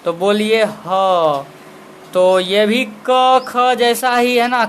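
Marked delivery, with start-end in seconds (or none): 0.6-1.5 ह drawn out slowly as a breathy h from the throat; ह sound demo. Shown as a demonstration. Teacher showing students where ह comes from, slow demo. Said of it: "ह को बोल करके देखना है".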